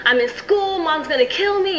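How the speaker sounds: high, panicky rush of worries; retold teen panic to her sister; scared. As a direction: spill the fears out in a panic. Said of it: "I was just scared".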